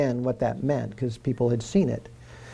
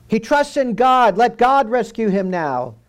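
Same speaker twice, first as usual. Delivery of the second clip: higher, louder scornful jeer; mocking taunt; 9 semitones higher, 6 dB louder than usual. A narrator's reading. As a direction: jeer at the dying man. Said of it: "were mocking him".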